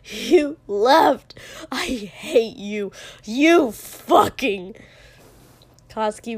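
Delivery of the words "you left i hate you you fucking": loud, slow, anguished yelling; tearful fury. A narrator's reading. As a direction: drag out each furious accusation. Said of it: "there were actually tears in his eyes".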